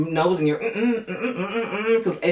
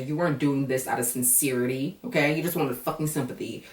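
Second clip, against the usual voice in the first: usual speaking voice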